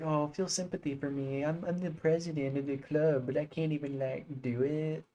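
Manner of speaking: lightly voiced mocking whine of the club president; a hint of a pleading schoolgirl, hammed-up; mocking Monika. Streamer mimics Monika's self-pity, mock whiny voice. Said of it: "She's like".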